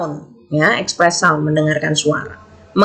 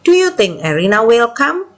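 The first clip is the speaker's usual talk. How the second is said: higher-pitched question, rising at "come"; intonasi bertanya; 4 semitones higher than usual. Shown as a demonstration. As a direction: ask "Do you think Erina will come?" as a teacher's model, ending upward. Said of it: "Kan bertanya". (intonasi bertanya, nada tinggi lalu naik di "come")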